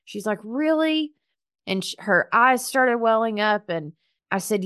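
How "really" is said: high, amazed "Really?"; touched, startled question; surprised disbelief. Retold or imitated. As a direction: ask it like you can't believe it. Stranger offered money, disbelief.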